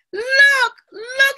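high, loud urgent cry; excited discovery. A narrator's reading. Amma digging up something in the sand, thrilled. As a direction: summon everyone to your find. Child finds buried treasure.

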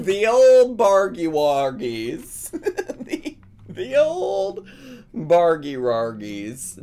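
slow, drawn-out mock echo with laughs; laughing imitation, stretched out; amused mimicry. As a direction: relish the silly words slowly. Echoes clip's odd phrase, amused.

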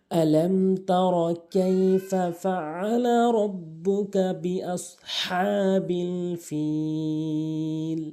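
high, very slow melodic chant; Quran recitation. Shown as a demonstration. Teacher reciting the whole sura for attentive learners, melodic Quran recitation. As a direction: recite in tajweed with long, held endings.

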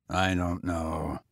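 low, slow, bitter tramp. A narrator's reading. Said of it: "he said bitterly".

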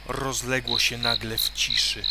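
ordinary delivery

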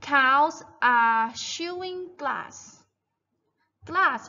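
very slow, stretched-out sentence; model reading for repetition. Shown as a demonstration. Teacher reads the farm text for children to repeat, slow model reading. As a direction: read it out slowly, word by word. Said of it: "Repeat after me, guys".

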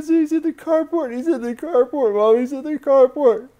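high, loud frantic repetition; screaming. Retold or imitated. Child telling mother where Dad is, screaming. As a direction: cry it out in a hysterical rush. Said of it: "She starts screaming".

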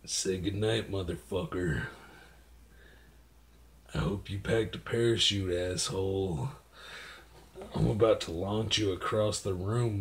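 low, menacing mutter of a threat; evil thoughts. Pretend. Evil thoughts muttered at the dog, low and menacing. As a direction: quietly threaten the dog like a villain. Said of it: "evil thoughts about your dog".

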